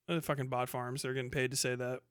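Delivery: ordinary talk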